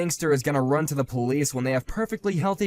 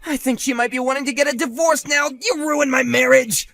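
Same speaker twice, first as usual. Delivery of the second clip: loud, high, accusing yell; blaming shout; 5 semitones higher, 4 dB louder than usual. Acted. Husband blaming the rival for his failing marriage, angry shout. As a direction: hurl the blame at him.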